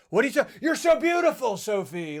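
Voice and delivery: hammed-up anime dub voice; loud, high, overblown anime exclamation. Mocking actor's anime line to Sophie, overblown. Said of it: "that sounded very anime to me".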